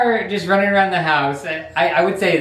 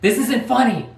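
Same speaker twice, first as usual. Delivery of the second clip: mock yell up the stairs; 10 semitones higher than usual. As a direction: yell upstairs that this isn't funny. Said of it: "he's, like, yelling up the stairs".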